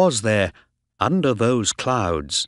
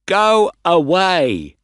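loud, drawn-out angry yell; angry shout; 8 semitones higher, 7 dB louder, slower than usual. A narrator's reading. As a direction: shout it high and slow to send them off. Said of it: "he shouted".

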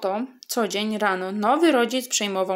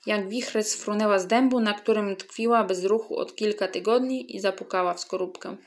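ordinary delivery

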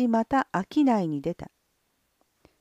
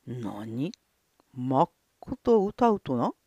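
low, slow doubting question; skeptical lord; 8 semitones lower, much slower than usual. Acted. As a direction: question it with suspicion. (疑う殿様の声)

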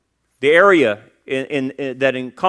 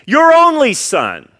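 Your only son, raised high and loud, slowed on only; emphatic quote; 10 semitones higher, 8 dB louder than usual. Acted. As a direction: proclaim it with force. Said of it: "threefold identification".

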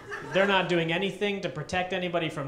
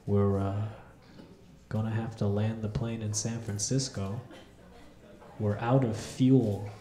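nonchalant pilot; 9 semitones lower, 4 dB quieter than usual. Retold or imitated. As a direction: announce disaster like nothing matters. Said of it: "He goes / So nonchalant".

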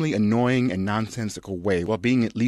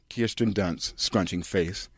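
normal talking voice

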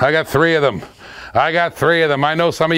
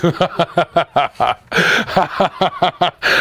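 long laugh. Real, not acted. Cracking up at chat.

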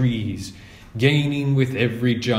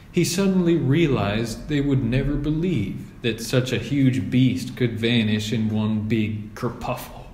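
usual speaking voice

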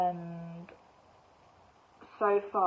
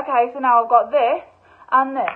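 louder, higher eager tally; thrilled; 5 semitones higher, 10 dB louder than usual. Retold or imitated. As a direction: gloat over her finds. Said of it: "I was like".

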